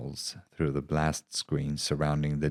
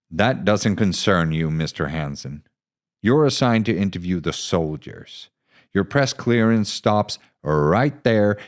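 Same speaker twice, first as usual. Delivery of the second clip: curt, louder, higher officer brushing him off; lightly voiced hostile officer; hostile captain; 3 semitones higher, 7 dB louder than usual. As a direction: shut the reporter down. Bede brushing off reporter.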